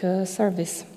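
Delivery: ordinary talk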